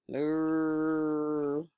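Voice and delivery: cow moo; mock moo. Cow noise in picture book.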